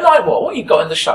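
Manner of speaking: put-on loud, high old woman challenge; put-on elderly voice demanding proof; sceptical old lady. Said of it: "And she didn't believe me".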